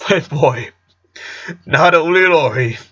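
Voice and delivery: high-pitched, amused storytelling on the edge of laughter; tickled, fighting laughter. A narrator's reading. Father telling his son how he teased little Lori, tickled. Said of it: "had to stop for a few seconds so he could laugh".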